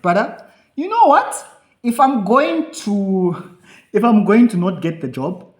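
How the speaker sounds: high and loud, talking himself into it; rally yourself like a coach in your own head; pumped-up pep talk. Retold or imitated. To himself before applying, a loud self-pep talk.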